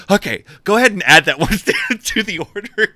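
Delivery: high, loud, hurried agreement with laughter; mock scared. Scared buyer adds it to the order, mock nervous. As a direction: give in fast, laughing through mock nerves. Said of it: "I was so scared".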